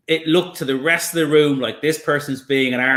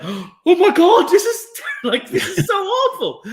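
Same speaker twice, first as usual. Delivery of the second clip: much higher, slow, half-laughing horror; horrified realisation; slower than usual. Retold or imitated. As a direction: gasp it out, appalled and giggling. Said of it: "they just went".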